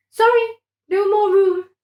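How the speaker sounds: high, loud squeaky little voice; squeaky prawn. A narrator's reading. A crammed prawn turning them away, squeaky and high. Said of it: "squeaked another prawn".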